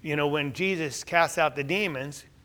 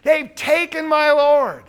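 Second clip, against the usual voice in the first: louder, higher, slow cry of loss; loud grieving quote; 8 semitones higher, 10 dB louder, slower than usual. Retold or imitated. Preacher voices Mary's grief to the gardener, loud and slow. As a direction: wail it out, slowed. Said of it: "she thinks he's a gardener".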